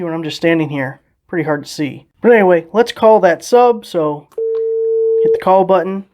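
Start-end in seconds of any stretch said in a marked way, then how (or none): none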